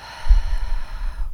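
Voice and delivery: big audible sigh out the mouth; heavy exhale sigh. Shown as a demonstration. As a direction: release the breath with a full sigh. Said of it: "One more".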